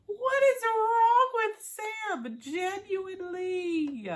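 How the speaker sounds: very high, slow, drawn-out wail; exasperated wail. Real, not acted. Viewer despairing over Sam's behaviour, exasperated wail. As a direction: wail every word out in despair. Wailing complaint about Sam.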